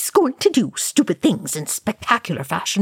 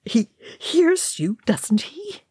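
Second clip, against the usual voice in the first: high, slow, anguished plea; anguished cry; 10 semitones higher, slower than usual. A narrator's reading. Oin begging Thorin about Gimli, anguished cry. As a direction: cry it out high, desperate for a yes. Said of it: "Oin cried in anguish".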